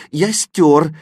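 plain reading voice

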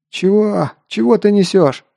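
high, indignant yelling; outraged. A narrator's reading. Wounded mercenary yelling at Artur in outrage. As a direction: bark back in disbelief.

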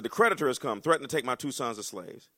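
declaimed widow's plea; scripture reading. Acted. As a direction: read her plea with urgency.

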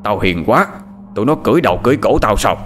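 brusque command, hurrying at the end; barked order. Acted. Lão Tước barking at Lục to dump the body, harsh. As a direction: snap the order at the servant. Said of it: "Hắn quát thằng Lục".

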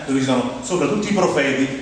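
usual speaking voice

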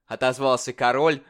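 ordinary delivery, nothing marked

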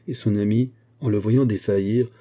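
plain reading voice

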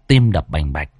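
plain reading voice

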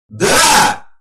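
high, loud battle cry; shout. A narrator's reading. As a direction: shout it out together. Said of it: "прокричали все стоявшие рядом бойцы".

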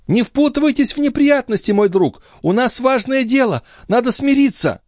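high-pitched urgent pleading; crying out. A narrator's reading. Chon begs Jean to avoid trouble, crying out. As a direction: plead in a high, carrying woman's cry. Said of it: "кричала Шон".

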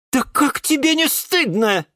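very high indignant reproach. A narrator's reading. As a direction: shame him with outraged pitch. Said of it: "возмутился Астра". (возмущённый упрёк)

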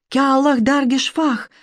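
high, slow eerie chant; wild cry. A narrator's reading. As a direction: cry out like a possessed girl. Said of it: "кричала она".

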